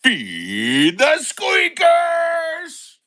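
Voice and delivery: high, slow, loud showman shout; announcer call. Acted. Streamer announcing the pet feeding segment, slow showman shout. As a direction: belt it like a game-show host. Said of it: "I'll go ahead and do the".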